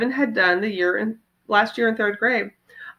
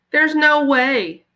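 slow, louder disbelief; flat disbelief; 5 semitones higher, 5 dB louder, much slower than usual. Acted. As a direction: refuse to believe it. Jessie refusing to believe.

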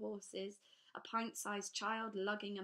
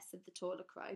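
ordinary delivery

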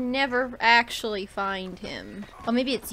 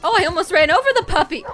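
alarmed; 5 semitones higher, 11 dB louder, faster than usual. Real, not acted. Nearly running over puppy.

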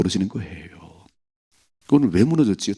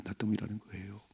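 때문이라는 repeated much softer, nearly breathed; hushed echo; 16 dB quieter than usual. Shown as a demonstration. Preacher repeating 때문이라는 quietly as David's confession. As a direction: echo 나 때문이라는 거예요 softly to self. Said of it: "repeated line".

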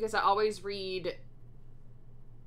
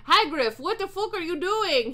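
high-pitched story shout, loud on the name; shouted outrage; 10 semitones higher, 5 dB louder than usual. A narrator's reading. Character catching Hagrid in the act, shouting. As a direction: cry out in a much higher voice. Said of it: "he shouted".

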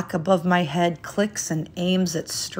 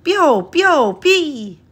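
high, louder birdlike chirping; canary chirp; 9 semitones higher, 7 dB louder, much slower than usual. A narrator's reading. Canary answering the landlady, in high chirps. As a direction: peep it like a little canary.

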